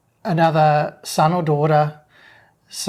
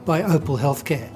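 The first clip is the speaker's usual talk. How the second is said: light ad read over music; bright sponsor sign-off; podcast outro. Acted.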